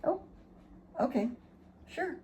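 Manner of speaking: high, soft indulgent pet talk; talking to a dog. Real, not acted. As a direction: give in sweetly to the dog.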